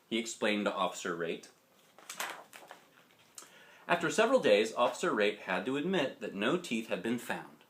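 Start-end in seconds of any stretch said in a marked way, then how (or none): none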